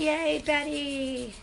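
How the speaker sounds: slow, loud, drawn-out cheer; happy cheer. Real, not acted. Host cheers viewer getting back online, excited. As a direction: stretch out a loud, happy yay.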